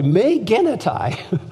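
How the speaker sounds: "Me genoito" drawn out, each syllable clear; Greek phrase, slow. Shown as a demonstration. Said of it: "emphatically no".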